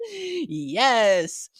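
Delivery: ordinary delivery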